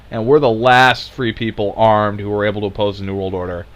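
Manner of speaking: ordinary talk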